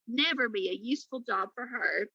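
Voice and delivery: usual speaking voice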